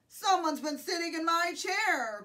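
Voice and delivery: loud storybook bear growl; Papa Bear growl. A narrator's reading. Papa Bear finds his chair used, growled. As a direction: growl it loudly as the big bear. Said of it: "growled the Papa Bear".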